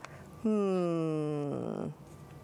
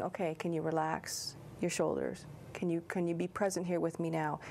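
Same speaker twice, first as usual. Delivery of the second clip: softer, gentle grounding questions; calming therapist; 2 semitones lower, 4 dB quieter than usual. Acted. Trauma therapist asking a client to relax, soft and calming. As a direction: gently settle a client into the present. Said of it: "when I work with someone in trauma".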